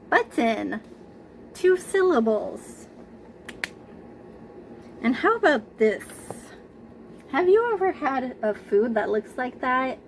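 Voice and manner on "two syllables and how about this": ordinary talk, nothing marked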